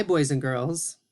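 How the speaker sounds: normal talking voice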